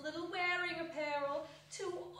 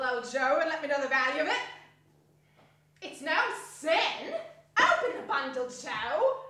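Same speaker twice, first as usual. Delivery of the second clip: much louder, laughing, pushy; brazen charwoman; 8 dB louder than usual. Acted. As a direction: hurry old Joe along. Charwoman urges old Joe.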